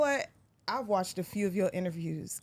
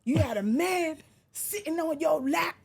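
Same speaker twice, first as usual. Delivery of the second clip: higher-pitched mock angry callout; 6 semitones higher than usual. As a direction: do the rival rapper ranting on camera. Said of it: "try to say it as best as he said".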